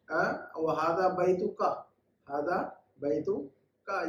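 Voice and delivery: slow, careful Hada बैतुका; slow model phrase. Shown as a demonstration. Teacher slowly models Hada बैतुका for students. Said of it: "एक के लिए".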